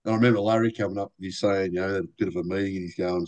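normal talking voice

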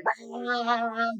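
high, soft imitation of a child's wail; a father lightly mimicking his small son's fit; mock kid tantrum. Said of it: "will just be like".

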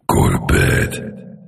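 very low, very slow deep voice; deep scary voice. Shown as a demonstration. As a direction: boom it out low and menacing. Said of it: "I turn the lights off and be like".

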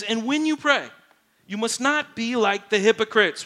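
full-force preacher quoting scripture; fervent preaching. Acted. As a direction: proclaim it to the back row.

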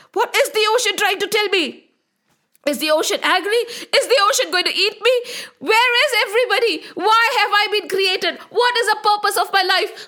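frightened questions. Acted. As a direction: ask in growing panic. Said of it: "Brahmaji said".

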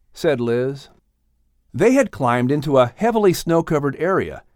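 usual speaking voice

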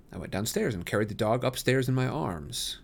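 plain reading voice

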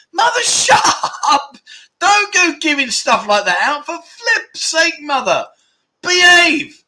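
high, loud playful telling-off of mum; embarrassed son shouting at his mum over chat's wig memory, mock outrage. Real, not acted. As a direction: scold your mum with a laugh, high and loud. Mum shares embarrassing memory in chat.

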